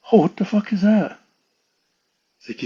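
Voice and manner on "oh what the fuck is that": retold startled question. Brother spots the creature, retold.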